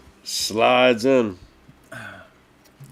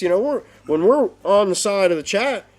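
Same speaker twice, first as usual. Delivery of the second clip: ordinary talk, nothing marked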